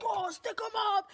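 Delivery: high, loud, slow mock robber voice; robber demand. Bank robber holding up the teller, hammed-up demand. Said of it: "He's like".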